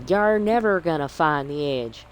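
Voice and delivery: hammed-up pirate with a Southern twang. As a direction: taunt them like a Southern pirate. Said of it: "Southern pirate".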